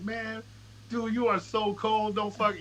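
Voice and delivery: high, stretched-out awe; imitating admirers. Bandmates texting praise after his exit, retold in high awe. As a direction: voice their praise high and slow.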